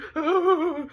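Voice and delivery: high-pitched drawn-out "ooh" sob; mock sobbing wail. Imitating sister crying over mom's body, high wail.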